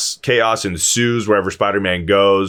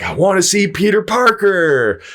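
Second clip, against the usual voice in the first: mock insistent wish; 8 semitones higher than usual. Repeating the magic wish.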